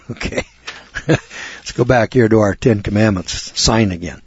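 ordinary talk

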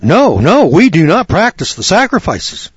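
high, loud, insistent no; adamant denial. Retold or imitated. The guy insists to the lawyer, adamant and loud. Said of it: "he was just adamant".

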